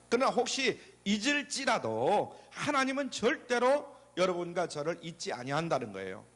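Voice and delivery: ordinary talk, nothing marked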